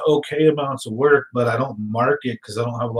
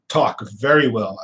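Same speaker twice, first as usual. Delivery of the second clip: ordinary delivery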